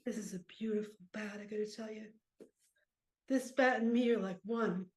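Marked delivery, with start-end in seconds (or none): 0.0-2.0 soft, reverent murmur; hushed reverence. Acted. Old player picks up the beloved bat, hushed reverence. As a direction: marvel quietly over a treasure.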